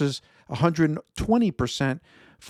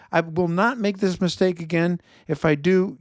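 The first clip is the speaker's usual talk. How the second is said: ordinary talk, nothing marked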